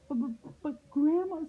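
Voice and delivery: high, stammering alarm; high-pitched girlish fright; frightened little girl. A narrator's reading. Girl sees the sharp teeth.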